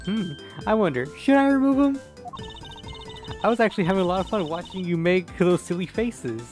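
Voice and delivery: put-on high, teasing girl voice; man doing a woman's voice. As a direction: tease him like a cheeky girl. Kay teasing the detective.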